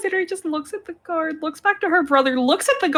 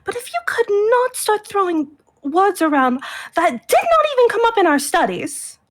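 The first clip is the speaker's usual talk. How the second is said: high, indignant chiding; prim exasperation; 5 semitones higher than usual. Acted. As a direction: scold with rising, high-pitched exasperation. Sister chides brother in character.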